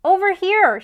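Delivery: loud call, pitched high; shout. A narrator's reading. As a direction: call for the ball across the court. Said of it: "shouted Larry".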